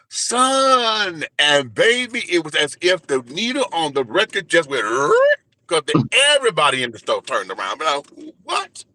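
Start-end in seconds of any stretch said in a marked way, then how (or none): 0.1-1.2 a retold father's single word, pitched up high. Father turning round to greet his son, high-pitched. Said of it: "he said". 8.4-8.8 a squeaked, much higher startled what; shocked what. Retold or imitated. Son startled as the whole store turns, high squeak.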